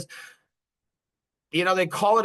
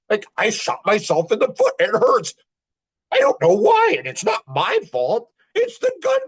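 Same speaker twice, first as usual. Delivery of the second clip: higher, rising whine of a fool; whiny dumb guy; 7 semitones higher, 4 dB louder than usual. Pretend. A man mocking fools, higher and whinier. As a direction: whine it like you can't figure it out.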